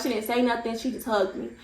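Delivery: ordinary talk, nothing marked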